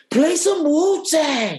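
very high, slow shouted request; calling out. Retold or imitated. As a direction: yell the request across the theater. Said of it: "the whole theater heard me".

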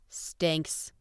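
soft whispered verdict, unbothered; a quiet, uncaring dismissal; impassive. A narrator's reading. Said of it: "said the girl impassively".

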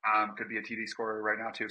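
normal talking voice

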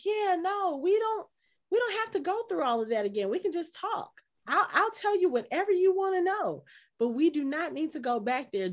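high, pleading retelling of Mark; panicked pleading. Retold or imitated. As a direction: beg frantically not to go back. Mark pleading, retold scene.

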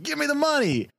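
shrill robber demand; high demanding yell. Acted. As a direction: yelp it out high. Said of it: "he's like".

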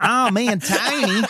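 high-pitched comic Australian impression; Australian accent. Podcaster mimicking an Australian skate host, high Australian accent. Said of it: "he is Australian".

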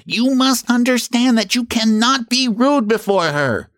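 high, insistent warning; stern warning. A narrator's reading. Moth defending Miss Cecropia to strangers, high stern warning. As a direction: lay down the law in a high voice.

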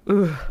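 loud grossed-out ugh; disgusted groan. Real, not acted. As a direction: recoil from the gore with a loud ugh. Reacting to gory detail.

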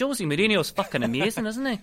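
Scottish pundit gushing over his favourite manager; Scottish impression. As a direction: gush in a put-on Scottish accent. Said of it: "quite a hay little pitch voice like this".

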